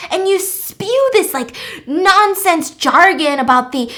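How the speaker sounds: loud, high-pitched outraged rant; losing all chill. Retold or imitated. Will confronts Eden at her dinner, loud outraged ranting. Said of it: "He loses all of his chill".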